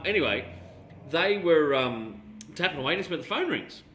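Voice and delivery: ordinary talk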